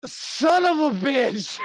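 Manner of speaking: high, drawn-out agonized shout; exasperated curse. Acted. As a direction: curse slowly at the top of the voice. Revived man curses his tormentors.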